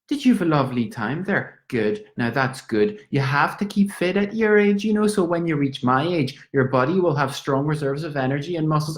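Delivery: put-on high, fussing old Irish lady; mother him like a chatty old neighbour; a high, doting Dublin landlady talking nonstop. Landlady voiced in play.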